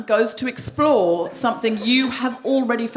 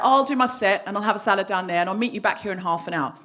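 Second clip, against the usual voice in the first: usual speaking voice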